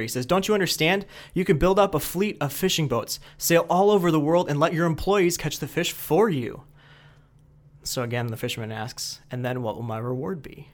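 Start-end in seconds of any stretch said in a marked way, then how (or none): 0.3-6.6 higher-pitched, insistent businessman; getting angry. Retold or imitated. Businessman lectures the idle fisherman, rising exasperation. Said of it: "starts getting angry". 9.3-10.7 a hushed, breathy ask; whispered question. Retold or imitated. Fisherman quietly repeats his question in a whisper. Fisherman asks again, calm.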